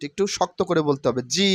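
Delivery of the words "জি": "জি" said a little hard and firm; hard sound. Shown as a demonstration. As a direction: press hard on "জি". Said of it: "একটু hard sound". (একটু শক্ত করে "জি")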